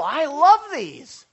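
high, loud and slow, gushing over the food; drawn-out, delighted praise; gushing compliment. Retold or imitated. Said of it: "people were coming by the table".

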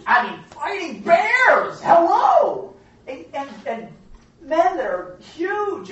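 loud, high, drawn-out exclamation; incredulous shout. Real, not acted. Teacher stressing David's feats to class, incredulous shout. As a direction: belt it out as if it's obvious.

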